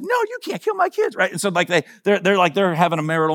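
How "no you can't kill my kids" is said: a hammed-up shrill plea to spare her children; protective mother goddess. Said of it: "she's like".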